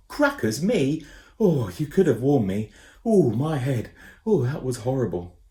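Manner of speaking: grumble, head spinning; dazed boy Ben. A narrator's reading. Ben complaining after landing.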